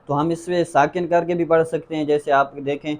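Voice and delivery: normal talking voice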